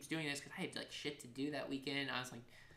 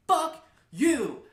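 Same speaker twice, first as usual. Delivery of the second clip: slow, high, very loud curse; retold screaming; 13 semitones higher, 14 dB louder than usual. DJ reenacting final insult to roommate, slow emphatic yell. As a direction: hurl each word out separately. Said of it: "and I'm screaming".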